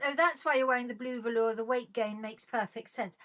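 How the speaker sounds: usual speaking voice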